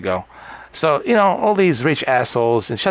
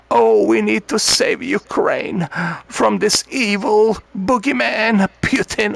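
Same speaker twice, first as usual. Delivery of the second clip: slow, higher, mock-earnest plea; mocking the rich lady; 8 semitones higher, slower than usual. Comic mimics a rich lady about Ukraine, high and slow. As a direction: parody a pampered lady's worried plea. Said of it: "even that lady this morning".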